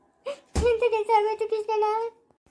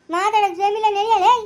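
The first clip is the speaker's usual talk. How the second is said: louder, impatient yell; urgent shout; 6 dB louder than usual. Acted. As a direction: hurry them off, no time to talk. Urging others to hospital. (ಆತುರದ ಕೂಗು)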